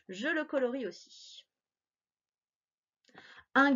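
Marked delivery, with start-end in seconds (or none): none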